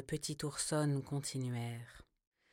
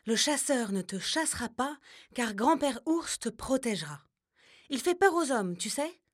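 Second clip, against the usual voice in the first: higher, lightly voiced reassuring grandmother; grandma bear; 7 semitones higher, 4 dB louder than usual. A narrator's reading. Grandma bear reassuring the cub about the hunter, higher and gentle. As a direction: reassure her like a loving old bear.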